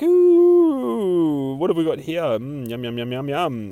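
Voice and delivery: high drawn-out ooh, easing down into relishing yums; hammed-up hungry cooing; hungry vampire girl. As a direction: coo over a tasty victim.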